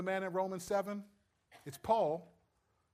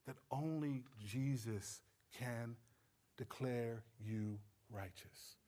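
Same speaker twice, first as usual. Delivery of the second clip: normal talking voice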